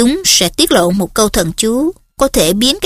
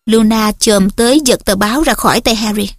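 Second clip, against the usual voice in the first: usual speaking voice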